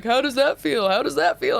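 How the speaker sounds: high-pitched teasing jab; mock taunt. Imitating a man teasing her when Eagles visited, mock taunt. Retold teasing from Chris Hill.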